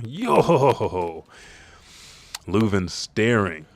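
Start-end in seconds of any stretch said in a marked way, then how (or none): 0.0-1.2 jolly chanted yo-ho-ho laugh; Brooke. A narrator's reading. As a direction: roll out an odd character laugh. Brooke's signature laugh acted out.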